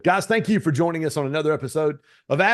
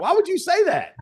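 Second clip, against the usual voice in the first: much higher, teasing disbelief; playful disbelief; 4 dB louder than usual. Retold or imitated. Mocking his friend's view, high and teasing. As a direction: toss the question up high, half-joking. Retold argument continues.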